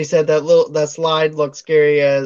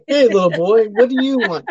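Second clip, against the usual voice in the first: put-on raised, hammy greeting to a child; lightly voiced Santa; 4 semitones higher, 3 dB louder than usual. Santa asks Ralphie what he wants, lightly hammed-up. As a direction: lightly play a store Santa questioning a kid.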